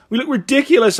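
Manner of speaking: put-on high, loud childish complaint; upset boy. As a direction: protest in a whiny boy voice. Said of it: "added Dick".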